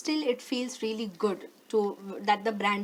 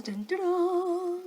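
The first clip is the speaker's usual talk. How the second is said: high, slow mock fanfare; dramatic drumroll; 7 semitones higher, slower than usual. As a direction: sing it like a suspense sting. Dramatic reveal sound.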